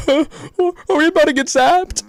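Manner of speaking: very high, loud alarmed squeal; panicked game character. Acted. Character fears a zap from the watch, high panicked voice. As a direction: pitch it way up in a panic.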